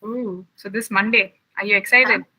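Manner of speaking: ordinary talk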